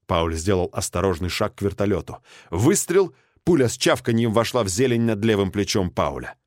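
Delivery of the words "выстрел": usual speaking voice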